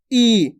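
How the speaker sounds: ई pressed and held as "eee"; stressed long vowel. Shown as a demonstration. Teacher models the big vowel for pupils, forceful long ई. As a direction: put force into ई and stretch it. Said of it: "थोड़ा जोर लग रहा है".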